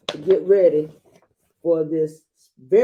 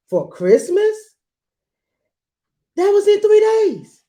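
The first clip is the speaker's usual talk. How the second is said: much higher and louder, amazed; astonished exclamation; surprised; 8 dB louder than usual. Real, not acted. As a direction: gasp at how soon it is. Reader reacts to story aside.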